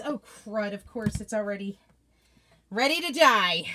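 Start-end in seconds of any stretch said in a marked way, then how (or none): none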